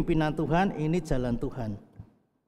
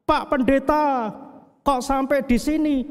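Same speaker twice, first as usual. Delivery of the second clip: retold shout; 11 semitones higher, 4 dB louder than usual. Shop owner calling out to the lost pastor, high and loud. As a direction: call out to the pastor in surprise. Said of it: "dia teriak".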